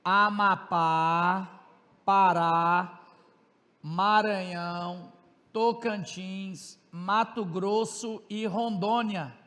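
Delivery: states spelled out very slowly for note-taking; slow dictation. Shown as a demonstration. Teacher repeating the states for students to copy, very slow. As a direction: draw out each state name so students can write.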